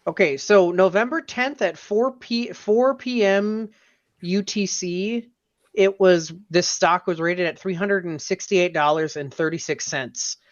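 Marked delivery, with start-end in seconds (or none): none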